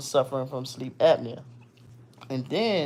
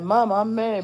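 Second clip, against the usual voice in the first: put-on higher, pouty little boy; angry child voice; 7 semitones higher, 4 dB louder than usual. Scene: son telling his mother he is angry, pouty child voice. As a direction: say it like a mad kid.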